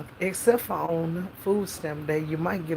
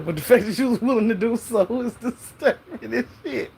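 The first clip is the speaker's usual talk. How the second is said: higher, louder, breaking into laughter; laughing through words; 4 semitones higher, 5 dB louder than usual. Real, not acted. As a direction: crack up while getting the words out. Cracking up at own story.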